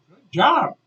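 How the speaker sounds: high, loud acted praise; punchy imitated coach; coach's praise. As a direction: cheer it on like a coach.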